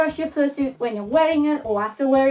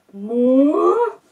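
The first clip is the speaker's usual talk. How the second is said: surprised; 6 dB louder than usual. Retold or imitated. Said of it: "you're probably all gonna be like".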